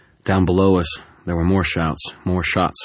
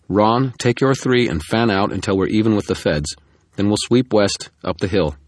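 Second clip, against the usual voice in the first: loud, raised commanding call across the woods; barked orders; 5 semitones higher, 6 dB louder than usual. Acted. Murphy directing her officers, loud commanding call. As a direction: project orders to a search team.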